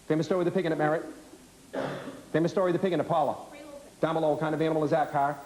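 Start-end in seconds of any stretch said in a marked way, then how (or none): 4.0-5.1 rapid-fire quiz question; breakneck pace. Shown as a demonstration. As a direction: fire the question off fast, teacher drilling a class. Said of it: "moving at this breakneck speed".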